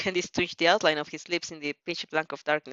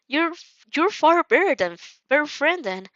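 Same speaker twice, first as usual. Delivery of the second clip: flustered; 8 semitones higher, 4 dB louder than usual. Acted. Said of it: "clearly flustered".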